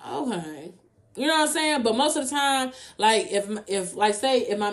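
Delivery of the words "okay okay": soft, restrained okay; acted, subdued acceptance; holding it back. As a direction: bite back the reply, very quietly. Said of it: "I hold it back".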